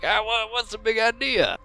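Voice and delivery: high, put-on protesting voice; indignant servant. Fake servant tackled by lawman, indignant high voice. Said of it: "I'll be like".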